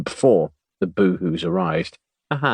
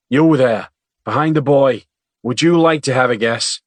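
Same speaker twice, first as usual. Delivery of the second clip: calling on her; 5 semitones higher, 6 dB louder than usual. A narrator's reading. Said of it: "as if noticing her".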